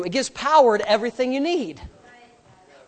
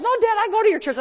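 put-on higher, light kid voice; imitating son; 9 semitones higher, much faster than usual. Son protests to his dad, a bit higher. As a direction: chirp back like a boy. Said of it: "He goes".

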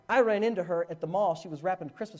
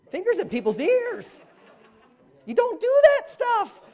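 loud, high, drawn-out protest; mock scolding outburst; 14 semitones higher, 6 dB louder, slower than usual. Preacher voicing objection to his own odd act, loud mock scolding. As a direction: holler it slowly, way up high.

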